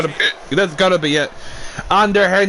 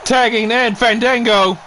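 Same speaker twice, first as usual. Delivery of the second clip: hyped wrestling commentary, higher and pushing; play-by-play call; 5 semitones higher than usual. Acted. Commentator urging Disco Inferno to fight back, excited play-by-play. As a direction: cheer the wrestlers on like a ringside announcer.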